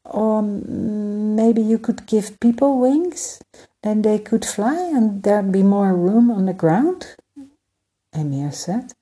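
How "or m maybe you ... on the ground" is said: lightly voiced boy, higher and eager; hopeful young prince. Prince Emir suggesting wings to Skymaker, hopeful boyish voice. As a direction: offer the idea shyly, hoping it works.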